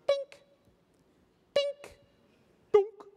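short, very high beeps, last one a dud; high-pitched blips, evenly spaced; imitated scanner beeps. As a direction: mimic a checkout scanner.

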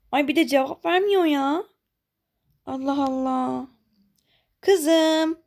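a mother calling out to a hidden stranger. Acted. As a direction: search and call into the dark.

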